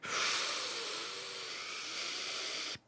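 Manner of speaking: long, soft, hissing breath out; drawn-out calming breath; long steadying exhale. Retold or imitated. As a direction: breathe out slowly to hold back panic. Re-enacting calming herself while scared.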